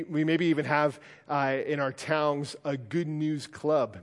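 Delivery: ordinary talk, nothing marked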